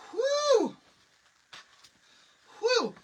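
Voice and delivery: high, loud whew of relief and stress; exasperated whew. Real, not acted. To viewers recalling the rush, loud whew. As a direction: blow out the tension.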